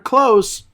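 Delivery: clothes without TH, a plain Z ending; close for clothes. Shown as a demonstration. Teacher giving the fix, clothes pronounced "kloze". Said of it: "just say the word clothes".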